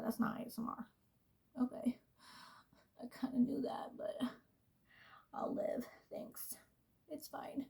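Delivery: soft breathy whisper. Acted. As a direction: whisper it close to the mic. Whispers after mentioning ASMR.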